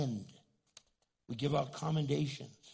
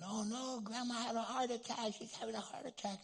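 higher-pitched mock panic; alarmed relatives; 7 semitones higher, 3 dB quieter than usual. Family sees Grandma collapse, retold alarm. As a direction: imitate a worried family's cry of alarm.

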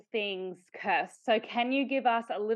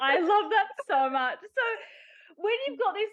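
laughing; 11 semitones higher, 4 dB louder than usual. Real, not acted. Host reacts delighted, laughing.

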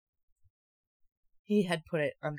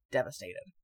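ordinary talk, nothing marked

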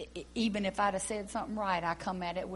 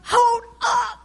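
loud stop; 13 semitones higher, 13 dB louder than usual. Acted. Leader halting the rehearsal, loud high call. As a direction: shout it high to cut the song. Cutting off the singer.

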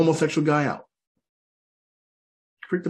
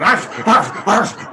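loud, high imitation dog bark; short, loud barks like a hyena pack; 7 semitones higher, 6 dB louder, much slower than usual. Audience turned into hyenas, imitated barking. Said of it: "Y'all start barking".

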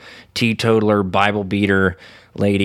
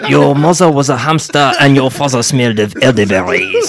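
mock French taunt; 4 semitones higher, 7 dB louder than usual. Quoting Monty Python taunts.

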